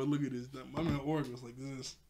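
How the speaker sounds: ordinary delivery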